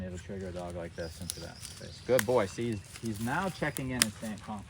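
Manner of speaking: usual speaking voice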